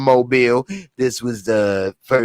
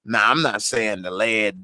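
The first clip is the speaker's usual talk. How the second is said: ordinary talk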